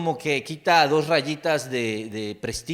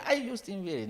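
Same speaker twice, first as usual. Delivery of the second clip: higher sighing fangirl, lightly voiced; mock swooning teen; 7 semitones higher, 5 dB quieter than usual. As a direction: swoon over the pop star like a teen daughter. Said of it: "pensé quizás en mi hija".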